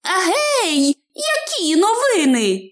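high-pitched hobbit hail; trembling call. A narrator's reading. Hobbit asks passing man for news, trembling high call. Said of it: "погукав він тремтливим голосом".

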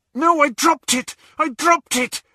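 high, loud, panicked cry; distressed outcry. Acted. Dreamer crying out over a lost thing, distressed. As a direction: wail it in a panic.

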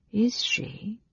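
soft whisper rising high; intrigued whisper. Acted. Mage learning of the eavesdropper, intrigued whisper. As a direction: whisper it as a sly question.

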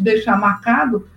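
ordinary talk

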